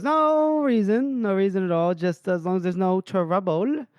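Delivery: nervous innkeeper. Retold or imitated. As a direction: stretch out the first "no reason", nervous. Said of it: "He goes".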